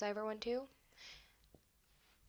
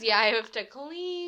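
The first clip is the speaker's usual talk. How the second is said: louder, slow drawn-out whine; mock whining; 6 dB louder than usual. Co-host listing weekend chores, mock whining. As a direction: stretch the last word into a moan. Acted list of adult chores.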